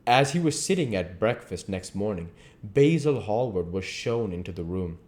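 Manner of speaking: usual speaking voice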